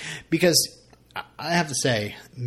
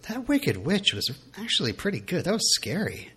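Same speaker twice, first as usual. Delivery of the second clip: higher, slightly softer mock praise; imagining someone's impressed aside, pitched up; imagined confiding praise; 7 semitones higher, 3 dB quieter than usual. Wife pulls husband aside about his reading voices, imagined confiding praise.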